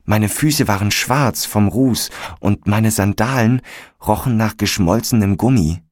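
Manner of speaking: ordinary delivery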